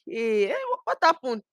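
high-pitched mock startled question; imitating puzzled mom. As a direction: play the mother's taken-aback reaction. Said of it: "my mom still just be looking like".

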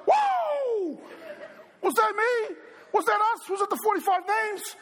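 long whoa, then excited high-pitched questions; excited disbelief. Retold or imitated. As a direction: blurt it out like a thrilled, astonished kid. Retold astonished childhood excitement.

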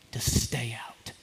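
slow, low whispered ending. Acted. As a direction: let it fall to a slow whisper. Jesus's voice, intimate plea.

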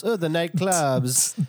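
slow, high, hammed-up French accent. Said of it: "You went French".

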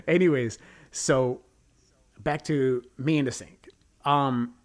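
ordinary talk

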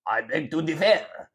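hammed-up haughty French accent. Friend proving the accent is possible, mock French accent. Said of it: "you can't do a French accent".

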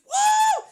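high shouted party whoop; hammed-up celebration cry; mock whoop. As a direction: whoop like a cheering crowd.

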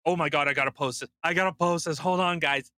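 hammed-up rush to post; mock frantic influencer; mock eager poster. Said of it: "But I don't go".

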